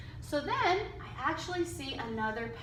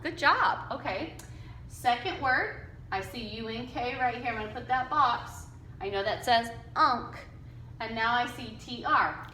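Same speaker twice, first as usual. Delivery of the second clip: ordinary talk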